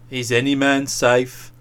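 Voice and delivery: low, pensive question; low-voiced brooding; musing. A narrator's reading. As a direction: wonder aloud in a low pitch. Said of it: "still musing".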